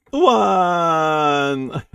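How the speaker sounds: long, high wail sliding down; loud mock sob, drawn out; crying wail. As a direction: bawl like a comic crybaby. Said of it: "So he's still crying".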